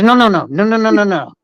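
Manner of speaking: rapid-fire run of mock refusals; worked-up, insistent repetition; retold agitation. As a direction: imitate a furious man cutting off excuses. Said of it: "He was so mad".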